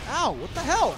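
usual speaking voice

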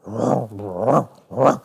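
low mock elephant rumble; a low, hammed-up elephant sound; elephant roar. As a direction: growl like a lumbering elephant. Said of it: "The elephant was going".

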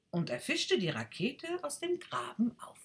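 plain reading voice

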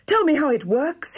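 put-on high-pitched, eager young prince; excited yell. A narrator's reading. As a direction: yell it, itching to have a go. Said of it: "he yelled".

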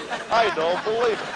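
laughing through the words, pitch jumping up; can't hold back the giggles on air; anchor stifling giggles. Real, not acted.

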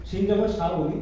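ordinary talk, nothing marked

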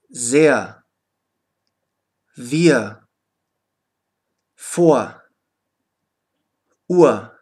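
final R as a short "ah" in "Sehr", "wir", "vor"; low falling model words, each R turned into a vowel. Shown as a demonstration. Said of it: "wird das R wie ein".

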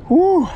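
sudden high, loud woo; excited whoop. Real, not acted. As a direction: let out a joyful cheer. Whoop after releasing fish.

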